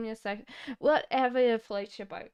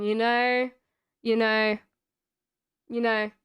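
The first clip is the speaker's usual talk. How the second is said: louder broad Australian you know; broad Australian accent; 6 dB louder than usual. Shown as a demonstration. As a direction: lay on the Aussie accent thick. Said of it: "very, very Australian".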